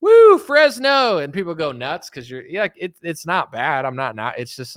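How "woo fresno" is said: high, loud drawn-out whoop; hyped performer greeting the audience; stage shout-out. Acted. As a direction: holler the town's name to a crowd. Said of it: "when you're on stage and you say the name".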